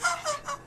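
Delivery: squeaky giggle; high-pitched laugh. Shown as a demonstration. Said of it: "this really high pitched kind of laughter".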